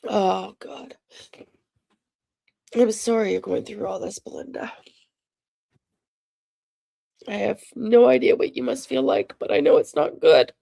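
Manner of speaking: fighting through real tears; tearful sympathy, breaking into whispers. Real, not acted. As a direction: offer comfort while choked up, voice catching. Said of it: "I'm gonna cry for you".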